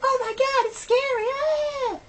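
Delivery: high, loud squeal of fake fright; hammed-up panicky screaming; mock scared. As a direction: imitate hysterical horror fans. Mocks scared film viewers.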